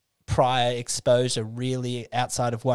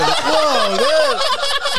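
high, loud, drawn-out stoned whoa; stoned awe; 14 semitones higher, 7 dB louder than usual. Retold or imitated. Mocking their tripping mate as a dazed stoner whoa. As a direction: gape like a tripping raver. Mates mock the tripping friend.

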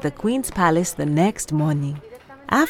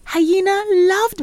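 high excited hyena voice; squeaky cartoon hyena; 12 semitones higher, 3 dB louder, slower than usual.

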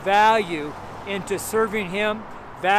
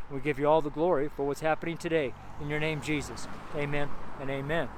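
softer, earnest street prayer; praying; 2 semitones lower, 4 dB quieter than usual. Acted. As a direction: pray it quietly, with faith. Prays for the street audience.

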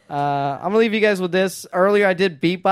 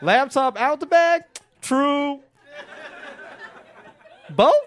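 ordinary talk, nothing marked

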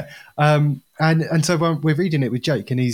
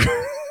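squeaky giggle; 6 dB quieter than usual. Acted. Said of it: "laugh still in alien voice".

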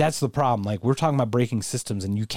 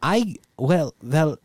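ordinary talk, nothing marked

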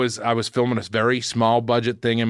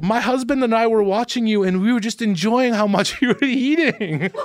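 put-on high, lively German-accented retelling; 10 semitones higher, 3 dB louder than usual. German wife gushing to a stranger about watching him eat, imitated German accent. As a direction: imitate a German woman, high-pitched, amused. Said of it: "Do your German accent".

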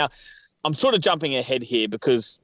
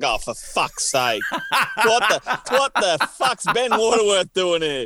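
mock exasperation; 7 semitones higher, slower than usual. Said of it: "I would have been like".

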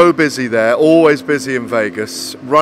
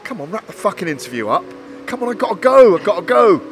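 impatient plea; 6 semitones higher than usual. Retold or imitated. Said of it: "I'm going like this in my hand to Andy".

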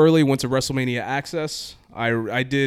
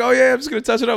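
much higher, louder easygoing painter; breezy painter; 12 semitones higher, 7 dB louder, faster than usual. Retold or imitated. The painter explaining himself, high and breezy. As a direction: shrug it off like a painter. Painter voiced in retold scene.

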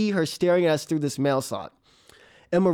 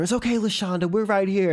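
usual speaking voice

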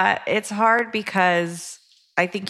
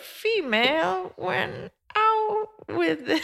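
slow, much higher, creaky mock British old woman; old shrew British accent; 11 semitones higher, 4 dB quieter, much slower than usual. Co-host reads the letter in a mock old British woman voice. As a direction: stretch it out like a British old crone in a tree. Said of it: "not best old shrew who lives in a tree".

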